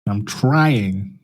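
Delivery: ordinary talk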